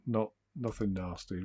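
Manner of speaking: usual speaking voice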